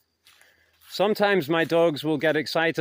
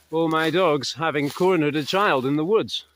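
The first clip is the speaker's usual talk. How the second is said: normal talking voice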